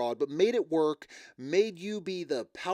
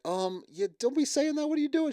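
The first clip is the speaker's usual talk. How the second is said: much higher, pleading scold in his voice; mock embarrassed boyfriend; 12 semitones higher than usual. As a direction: squeak it out like a mortified partner. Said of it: "he's like".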